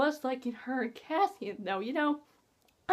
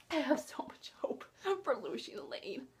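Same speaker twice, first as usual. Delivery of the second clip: secret whisper; 11 dB quieter than usual. Real, not acted. Confessing a secret ship hope.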